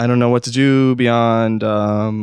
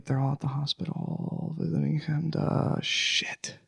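hushed realization; 2 semitones higher, 13 dB quieter than usual. Acted. Realizes friend is in hospital.

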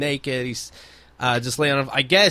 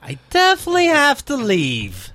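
mock high voice; 10 semitones higher than usual. Mock exchange with the woman.

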